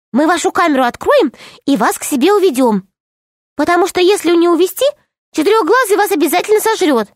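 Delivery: quick, high little gnome, hushed; fast gnome whisper. Acted. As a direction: rush the plan like a tiny conspirator. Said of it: "начал быстро шептать". (быстрый шёпот гнома)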